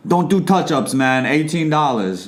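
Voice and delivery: put-on barber with a strong New York accent. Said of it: "Good New York accent".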